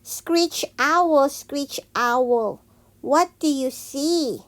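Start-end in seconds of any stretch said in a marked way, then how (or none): none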